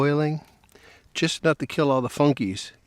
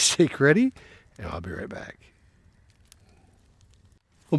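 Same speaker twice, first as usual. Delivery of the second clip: normal talking voice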